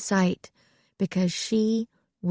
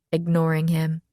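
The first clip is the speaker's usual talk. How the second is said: ordinary delivery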